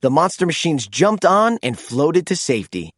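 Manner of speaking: usual speaking voice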